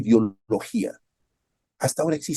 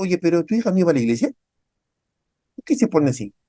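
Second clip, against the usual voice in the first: snide neighbour; 5 semitones higher, 3 dB louder, faster than usual. Retold or imitated. Neighbour judging a grieving parent.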